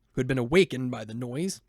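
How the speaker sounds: ordinary delivery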